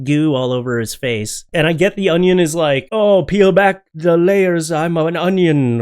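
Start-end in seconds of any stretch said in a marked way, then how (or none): 2.9-5.8 slow, higher, louder mock Shrek; imitating Shrek. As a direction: drag it out like a hammed-up ogre. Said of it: "or whatever he said in the movie".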